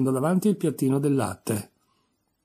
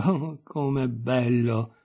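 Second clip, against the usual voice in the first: exclaiming; much slower than usual. A narrator's reading. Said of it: "esclamò Alice".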